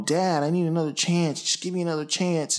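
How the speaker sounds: annoying begging. Retold or imitated. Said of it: "the little annoying kind of begging".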